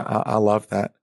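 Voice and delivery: ordinary talk, nothing marked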